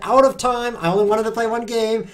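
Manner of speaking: loud, high, exasperated cry over the clock; frustrated outcry. Real, not acted. Streamer losing on time calls out in frustration, loud and high. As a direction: wail at the lost game, half laughing. Runs out of time, loses game.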